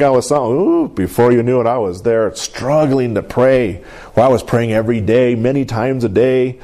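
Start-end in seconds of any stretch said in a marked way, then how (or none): none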